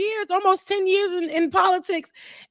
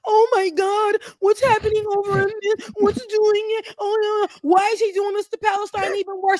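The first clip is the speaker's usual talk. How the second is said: higher, fretful mock panic; mock panicked voters; 7 semitones higher than usual. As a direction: play the clueless worried voter. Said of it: "people are gonna be like".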